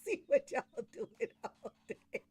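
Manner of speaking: high, slow words squeezed out through laughter; barely getting it out for laughing; cracking up mid-sentence. Real, not acted. Joking to coworkers, laughing hard.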